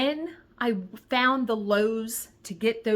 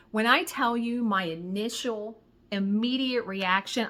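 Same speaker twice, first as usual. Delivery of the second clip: ordinary delivery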